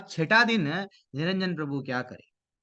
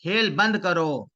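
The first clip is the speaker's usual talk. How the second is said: put-on raised voice, telling children off; scolding neighbours; 4 dB louder than usual. Apartment residents to children playing cricket, loud scolding. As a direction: complain loudly, drawing out the last words. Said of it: "सब बच्चों को बोलते".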